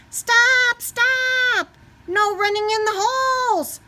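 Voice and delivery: high, loud, stern shout; principal shouting. Acted. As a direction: yell down the corridor to halt him.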